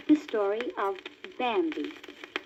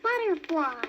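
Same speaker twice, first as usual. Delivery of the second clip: high eager little fawn; excited cry; 7 semitones higher than usual. A narrator's reading. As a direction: call out delighted. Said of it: "he cried".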